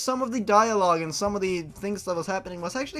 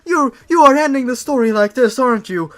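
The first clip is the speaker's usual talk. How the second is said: loud, high disbelieving rant; half-laughing cries of disbelief; exasperated outburst; 6 semitones higher, 9 dB louder than usual. Real, not acted. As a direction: rant in real shock at the ending. Reacting in disbelief to story ending.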